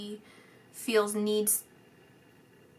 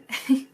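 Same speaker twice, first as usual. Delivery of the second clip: short laugh; 3 semitones higher, 5 dB louder than usual. Real, not acted. Laughs at sign-off.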